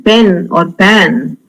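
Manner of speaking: normal talking voice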